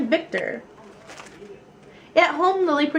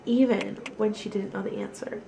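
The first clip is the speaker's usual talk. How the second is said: usual speaking voice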